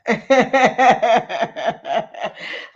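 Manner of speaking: short amused laugh. Real, not acted. Greeting the viewers then laughing at herself. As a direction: laugh it off at the memory.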